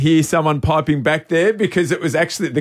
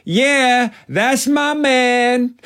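high, slow, drawn-out taunting yell from the stands; imitating a heckler mocking the opponent's shooter; mock crowd heckle; 10 semitones higher, much slower than usual. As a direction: stretch it out to put the shooter off. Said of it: "another bloke from the opposition yelling out from the crowd saying".

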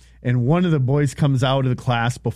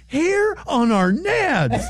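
slow, much higher, dazed repeat; stunned kid; 13 semitones higher, much slower than usual. Retold or imitated. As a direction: let it sink in, stunned and slow. Second boy stunned, repeats.

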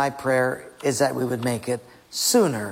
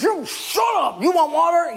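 furious Moses shout; 14 semitones higher, 3 dB louder than usual. Acted. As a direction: bellow it down at the crowd.

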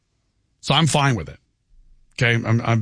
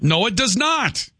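higher, slow, every word weighted; emphatic contradiction; 5 semitones higher, slower than usual. Real, not acted. Host arguing with caller over tacos, emphatic contradiction. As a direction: flatly refuse to give in, slowing down.

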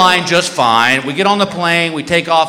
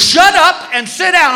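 high, slow barked order; hammed-up yell at a passenger; mock shout; 12 semitones higher than usual. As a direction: flight attendant barking at the comedian, mock shout. Attendant yells at passenger.

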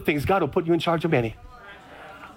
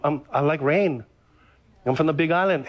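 ordinary delivery

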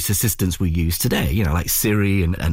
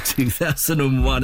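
ordinary talk

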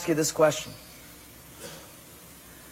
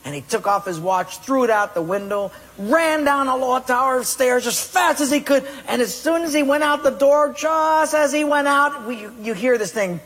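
ordinary talk

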